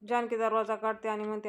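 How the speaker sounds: usual speaking voice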